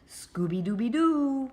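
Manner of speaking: loud, lilting dog catchphrase; Scooby catchphrase. Acted. Scooby sings out his catchphrase at the end. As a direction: sing out the catchphrase.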